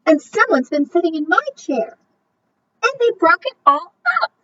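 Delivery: high, small, quick cub voice; wee small Baby Bear voice. A narrator's reading. Narrator voicing Baby Bear over his broken chair, high and small. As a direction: squeak it out, a tiny upset cub. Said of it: "in his wee small voice".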